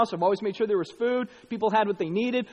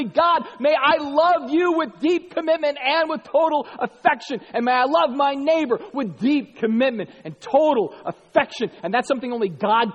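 louder, higher preaching voice; 7 semitones higher, 6 dB louder than usual. Acted. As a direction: preach it out with fervor. Preaching with full force.

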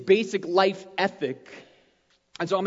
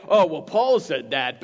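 mock skeptic; 5 semitones lower, 3 dB louder than usual. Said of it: "They'll be like".

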